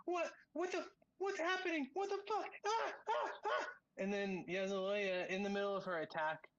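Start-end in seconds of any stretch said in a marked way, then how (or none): 0.0-3.6 shrill, terrified screams climbing very high; a man waking up in horror, shrieking; panicked screaming. Acted. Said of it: "at first he just starts screaming".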